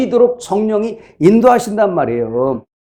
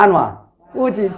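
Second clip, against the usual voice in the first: ordinary talk, nothing marked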